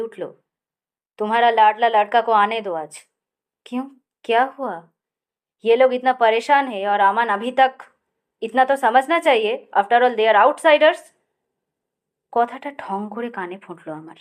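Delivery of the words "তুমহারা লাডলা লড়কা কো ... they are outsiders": harsh louder rebuke. A narrator's reading. Akash talking harshly to his wife about their son, harsh angry tone. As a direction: speak coldly and harshly. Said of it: "অস্বাভাবিক রূঢ় গলায়".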